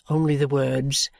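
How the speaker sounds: plain reading voice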